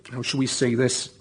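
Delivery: ordinary talk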